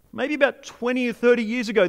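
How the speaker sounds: ordinary talk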